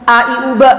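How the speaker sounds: "ba" snapped shut in the throat; hamzah stop. Shown as a demonstration. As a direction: end "ba" short on a glottal stop. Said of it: "punya syiddah".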